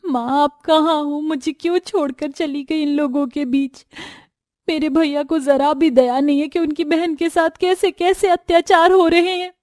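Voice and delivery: high-pitched, weepy wailing; tearful lament. A narrator's reading. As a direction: cry out for your absent mother. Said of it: "रोते हुए अपनी माँ को याद".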